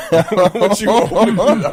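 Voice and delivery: high, loud laughing tease. Real, not acted. Teasing his cohost about the letter, laughing high and loud. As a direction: giggle the jab out.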